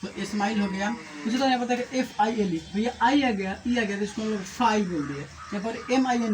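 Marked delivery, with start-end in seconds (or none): none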